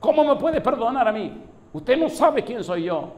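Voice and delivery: high, loud outraged protest, building; imitating an enraged unbeliever shouting; furious disbelief. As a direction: climb from loud objection to strained high yell. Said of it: "furiosos, me dicen".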